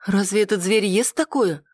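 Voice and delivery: puzzled. A narrator's reading. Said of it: "с недоумением спросила".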